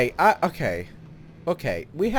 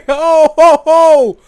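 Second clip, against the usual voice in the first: loud, high laughing whoops; whooping laughter; 13 semitones higher, 16 dB louder, much slower than usual. Real, not acted. Gamer watching the cannon fly off, whooping laughter. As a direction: laugh it out in helpless amazement.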